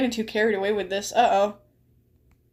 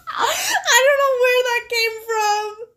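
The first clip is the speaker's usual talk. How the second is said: high, slow, wheezing laughter; cracking up helplessly; laughing; 11 semitones higher, 3 dB louder, slower than usual. Real, not acted. Cracking up at own line.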